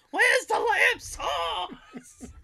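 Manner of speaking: high, long-drawn yell breaking into laughter; imitated yelling. Host imitates chef yelling at kitchen contestants, mock yell. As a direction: holler it high and stretch every word. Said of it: "yelling at Hell's Kitchen contestants, going".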